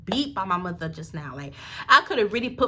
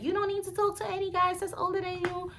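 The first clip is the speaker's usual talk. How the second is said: high, softer mocking lecture voice; mock lecturing; 10 semitones higher, 6 dB quieter, faster than usual. Lady cop warning a girl about older guys, mock lecturing. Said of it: "Y'all know the whole lecturing".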